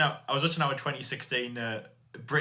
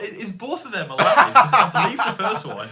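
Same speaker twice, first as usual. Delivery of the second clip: laughing; 6 semitones higher, 6 dB louder than usual. Real, not acted. Cohost laughing in disbelief.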